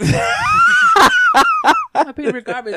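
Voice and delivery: laughing mock whine. Mock whining through laughter.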